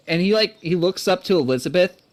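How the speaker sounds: normal talking voice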